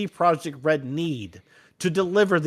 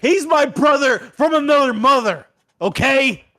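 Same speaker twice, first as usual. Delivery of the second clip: loud, high shout; 8 semitones higher, 6 dB louder than usual. Acted. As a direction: yell it out big. Shouting the punchline at the CEO.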